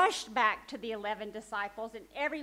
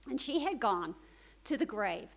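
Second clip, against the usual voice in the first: ordinary delivery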